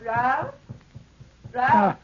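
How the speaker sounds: high, loud call from another room; aunt calling her nephew loudly from upstairs. Acted. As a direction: call his name through the house. Aunt calls from her bedroom.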